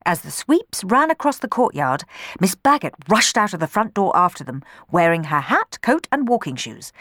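plain reading voice

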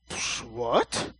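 breathy dismissive scoff; the crowd scoffing at Jesus; scoffing disbelief. Retold or imitated.

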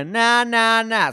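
high, loud drowning-out chant; shouting over someone. Retold or imitated. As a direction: block it out with loud nahs, fingers in ears. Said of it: "shout over it while they were doing the take".

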